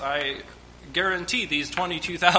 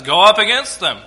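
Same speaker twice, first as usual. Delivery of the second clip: commanding God; 3 semitones higher, 11 dB louder than usual. Retold or imitated. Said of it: "So the Lord again says".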